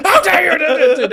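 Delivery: loud, high, slow mock outrage through a laugh; hammed-up how-dare-you roar; mock bellow. Teacher jokingly acting out bellowing at a pupil, loud mock outrage. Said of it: "And bellowed at him".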